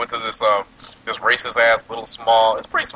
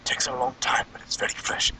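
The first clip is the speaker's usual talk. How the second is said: whispered waiter; 2 semitones higher, 8 dB quieter than usual. Retold or imitated. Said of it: "He was like".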